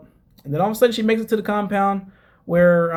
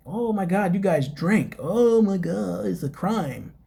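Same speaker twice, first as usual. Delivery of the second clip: mocking the mom; 3 semitones higher, 3 dB quieter, slower than usual. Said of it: "the mom is always talking about the drinking".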